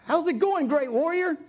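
high, loud, breezy greeting; chummy, high-pitched hello; casual vernacular. Acted. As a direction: hail him casually, pitched well up. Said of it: "in Tim Holt vernacular".

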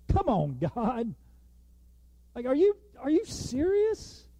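mock exasperated; incredulous protest. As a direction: push back at God in disbelief. Reader protesting to God.

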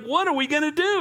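panicking servant. Acted. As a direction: ask it like the ground fell away. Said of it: "he's panicking".

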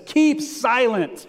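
slow, high, forceful command; letting them have it. Acted. Moses letting the people have it, slow exasperated command. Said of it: "I wish I could say it the way that".

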